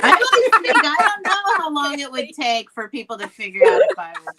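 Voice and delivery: laughing while talking. Real, not acted. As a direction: talk through real laughter. Laughing at death joke.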